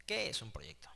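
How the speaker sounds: unvoiced, breathy whisper of the question; whispered repeat. Shown as a demonstration. Teacher repeating the question to students as a hushed aside, whispered. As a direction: drop to a secret whisper.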